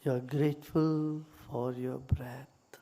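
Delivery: calm meditation guide with long pauses; guided meditation. Real, not acted. Teacher leading listeners into gratitude for body and breath, meditative. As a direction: guide them gently into stillness, unhurried.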